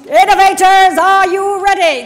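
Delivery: loud, slow arena call with a laugh; booming defiant gladiator. Acted. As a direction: boom it out like a gladiator host. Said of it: "now sound like the gladi".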